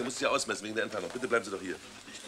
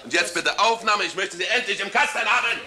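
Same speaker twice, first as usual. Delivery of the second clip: higher, louder orders to the crew; exasperated; 7 semitones higher, 4 dB louder than usual. Acted. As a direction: push everyone to finally shoot. Exasperated director rallies the crew.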